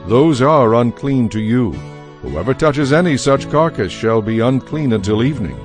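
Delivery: ordinary talk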